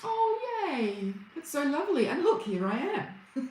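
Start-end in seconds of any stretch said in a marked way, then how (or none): none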